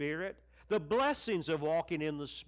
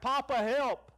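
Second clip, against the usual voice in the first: raised, pleading call for help; crying out to Papa; 6 semitones higher than usual. Retold or imitated. Preacher showing his congregation how he cries out to God, pleading and raised. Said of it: "I cry out".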